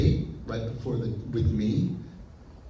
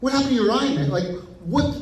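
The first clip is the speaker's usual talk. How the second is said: high, loud, acted alarm; freaking out; 13 semitones higher, 6 dB louder than usual. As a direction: blurt it out in stunned disbelief. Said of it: "He's, he's freaking out".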